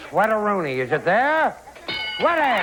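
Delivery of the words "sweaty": high game-show call; calling out answer. Real, not acted. Host asks the board for the answer, calling out. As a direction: send it up to the board.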